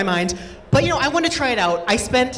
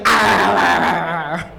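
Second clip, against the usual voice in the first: mock savage growls; 4 semitones lower, slower than usual.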